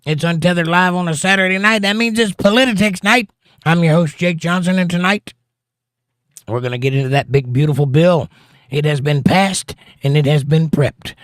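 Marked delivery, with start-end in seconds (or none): 0.1-5.3 big, higher-pitched welcome to the audience; show host opening. Real, not acted. Host greets live audience on politics night, big show-opening voice.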